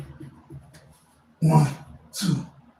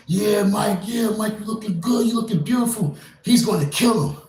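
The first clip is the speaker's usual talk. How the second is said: high, loud hyped-up cheering; root for him like a fan ringside; cheering Mike on; 8 semitones higher, 5 dB louder than usual. Retold or imitated. Fan cheering Mike Tyson on at the fight, high and loud. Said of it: "many of us just had, had it in us".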